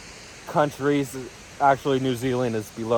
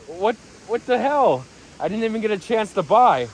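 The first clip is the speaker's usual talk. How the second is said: higher-pitched mock-incredulous whine of complaint; exasperated disbelief; 7 semitones higher than usual. Trader recalling a missed chance to buy, in exasperated disbelief. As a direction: replay being caught off guard, voice jumping up.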